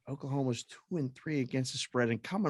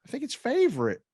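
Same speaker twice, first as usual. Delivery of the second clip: high-pitched, comically unsure pick; mock uncertainty; 11 semitones higher, 5 dB louder than usual. Host re-enacting his pick of the favorite, comically unsure. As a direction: offer it up high, in mock doubt.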